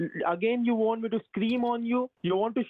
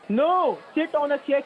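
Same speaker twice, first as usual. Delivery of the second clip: higher, loud snap of a command; exasperated order; 6 semitones higher, 4 dB louder than usual. Real, not acted. Husband telling his wife to sit down, loud sharp order. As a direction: shut it down firmly.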